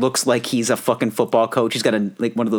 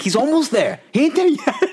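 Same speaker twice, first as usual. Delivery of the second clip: mock stubborn dad, much higher; 14 semitones higher than usual. Dad refusing to praise his son, mock stern father. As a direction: imitate the withholding father, "He ain't there yet" pitched up. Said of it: "refuses to tell him to his face that he's proud".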